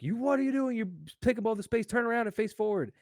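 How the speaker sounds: high-pitched mock scolding; indignant stranger imitation. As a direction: scold in a put-on high voice, rushing on. Imitating the man who elbowed him.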